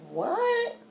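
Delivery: a high, hushed, stunned what. Retold or imitated. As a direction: breathe out a baffled what. Stunned reaction to his glare.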